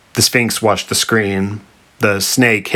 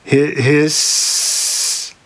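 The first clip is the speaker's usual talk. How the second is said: halting, higher start into a long hiss; snake hissing; 6 semitones higher than usual. A narrator's reading. Snake adds a late hiss after the Sphinx, halting hiss. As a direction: stumble in, then draw out the s. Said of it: "the snake added".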